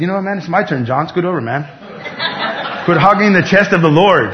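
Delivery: lightly voiced disciple shoving for his turn; pushy disciple. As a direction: play a jokey disciple elbowing in. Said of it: "All of them could have said".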